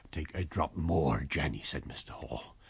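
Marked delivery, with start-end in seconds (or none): 0.1-1.6 soothing husband. Acted. Husband soothes fainting wife.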